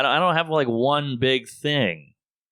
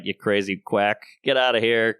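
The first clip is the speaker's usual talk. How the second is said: ordinary talk, nothing marked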